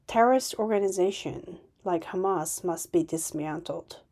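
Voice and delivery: normal talking voice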